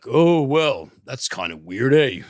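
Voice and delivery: put-on a light, hammy Canadian accent; a half-hearted Canadian "eh". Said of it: "that's a terrible Canadian accent".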